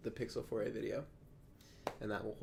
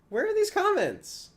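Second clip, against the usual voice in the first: surprised; 11 dB louder than usual. Real, not acted. Surprised comments suddenly appear.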